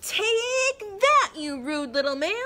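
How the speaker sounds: high loud witch cry. Acted. Witch zaps the rude gnome, high and loud. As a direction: hit him with a spell.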